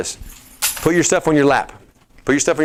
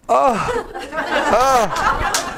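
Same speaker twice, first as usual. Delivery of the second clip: high-pitched imitation of amazed "oh"; hammed-up high gasp of relief; mock overjoyed gasping; 12 semitones higher than usual. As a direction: act out someone finally breaking loose. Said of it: "they broke loose and they were like".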